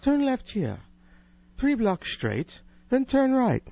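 high, prim British female sat-nav voice; mock British sat-nav giving directions; British accent. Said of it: "female voice with a British accent".

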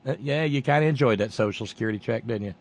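ordinary talk

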